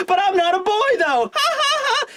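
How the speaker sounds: put-on very high child voice with laughter; giggling little girl. Creepy child reacting to name joke, high laughing protest. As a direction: pipe it up, then cackle in high bursts.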